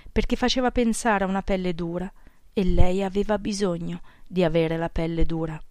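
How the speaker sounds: plain reading voice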